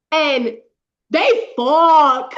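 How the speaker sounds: THEY FUCK belted loud and high; loud, drawn-out cry on "fuck"; emphatic excited repeat. Real, not acted. Reader telling friend the couple finally got together, emphatic excited repeat. Gleeful repeat of the big moment.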